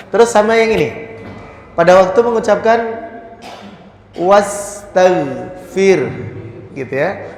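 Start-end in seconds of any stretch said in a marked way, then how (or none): 4.1-6.0 WASTAGHFIR slowly, vowelless R trailing into an H-like breath; sukun ending. Shown as a demonstration. Teacher showing the pause form, breathy sukun ending. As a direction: draw out WASTAGHFIR and end R on a puff. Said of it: "roknya disukun".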